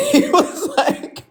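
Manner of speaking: real laugh breaking into the words; cracking up mid-story; high, real laughter running into "he was like". Real, not acted. Cracking up retelling it.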